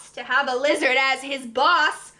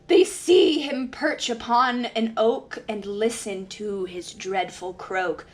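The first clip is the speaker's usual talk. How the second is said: ordinary delivery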